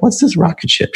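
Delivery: surprised question. Retold or imitated. Retold question about a drawing.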